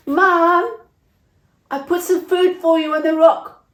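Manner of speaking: high, loud call across a distance; a child calling out to the mother living outside town. Acted. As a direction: shout it over to someone far away. Family calling to leper outside town.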